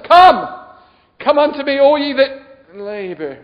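loud, high call easing slightly; proclaimed invitation. Acted. Preacher quoting Jesus to the weary, loud proclamation. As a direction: ring out the summons like scripture.

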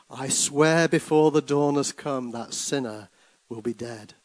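rhythmic, rising and falling recital; dramatic poem reading. Acted. Preacher reading a poem to church, dramatic. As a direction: read the ballad like a storyteller.

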